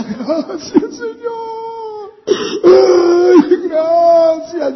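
high, drawn-out mock crying; imitated tearful wailing. Husband imitates his wife crying on her knees, wailing. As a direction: wail thanks like someone weeping in prayer. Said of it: "comenzó a llorar. Y decía".

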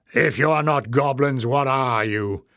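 ordinary delivery

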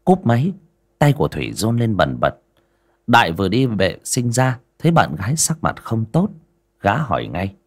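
plain reading voice

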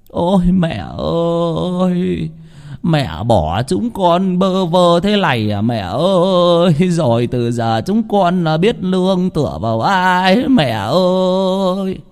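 high, slow, drawn-out mourning wail; keen over the coffin like a grieving son; wailing lament. Acted. Son wailing beside his mother's coffin, slow high lament. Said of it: "mếu máo".

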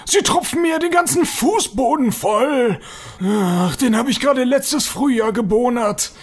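grumpy caretaker telling someone off; bad-tempered. Caretaker scolds visitors dripping on his freshly waxed floor, bad-tempered. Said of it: "er scheint schlechte Laune zu haben".

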